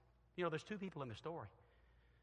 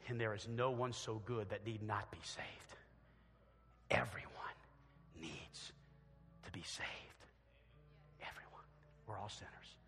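soft whisper, slowing down; hushed whispered close; 7 semitones lower, 6 dB quieter than usual. Real, not acted. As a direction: breathe it out, hushed and solemn. Solemn closing thought.

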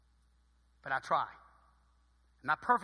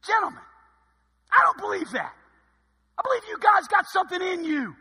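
loud, high rallying coach speech; locker room speech; 12 semitones higher, 10 dB louder than usual. Retold or imitated. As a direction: fire up the team like it's the big game. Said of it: "gave a speech".

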